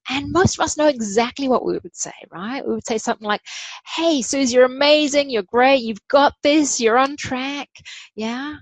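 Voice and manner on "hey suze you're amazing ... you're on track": higher and louder, upbeat encouragement; bright pep talk to a struggling friend; cheering a friend on. Retold or imitated. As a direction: hype up your best friend.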